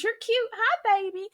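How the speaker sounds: put-on high, sweet baby talk to a crying infant; cooing at a baby. Greeting a stranger's baby, high cooing baby talk. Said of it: "I'm like".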